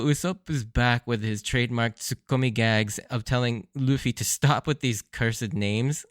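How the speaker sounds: ordinary talk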